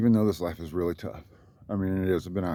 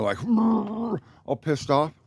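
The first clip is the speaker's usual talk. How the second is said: ordinary talk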